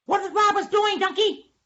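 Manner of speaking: high squeaky question; little animal voice. Acted. Small animal asks donkey about robbers, high squeaky voice. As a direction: pipe it up very high.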